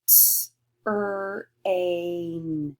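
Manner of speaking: "T, er, a" split into separate slow sounds; slow robot-like segmenting; sounded out slowly. Shown as a demonstration. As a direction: pull the word train apart sound by sound. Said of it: "really, really slow, like a robot".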